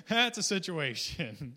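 loud, slow, through a laugh; real chuckling emphasis; amused. Real, not acted. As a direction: draw it out loudly, tickled by the understatement. Laughing over the desert predicament.

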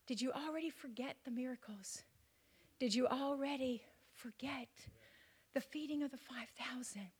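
urgent whispered questions; hushed questions to the congregation. Acted. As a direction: whisper it close to the mic. Preacher whispers rhetorical questions.

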